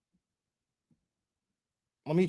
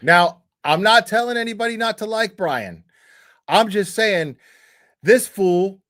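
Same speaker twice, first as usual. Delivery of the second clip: loud, higher, heated rant; indignant louder rant; angry rant; 4 semitones higher, 4 dB louder than usual. Real, not acted. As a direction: call him out, fired up. Streamer ranting about Brian.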